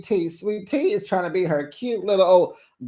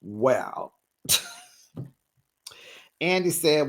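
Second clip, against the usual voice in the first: normal talking voice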